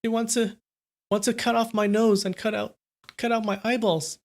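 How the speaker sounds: put-on high, halting frightened boy; trembling voice. A narrator's reading. Terrified son tells dad of the threat, trembling. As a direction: stammer it out high, like a scared son. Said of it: "said with a trembling voice".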